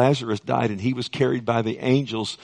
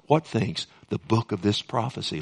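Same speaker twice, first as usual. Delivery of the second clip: ordinary talk, nothing marked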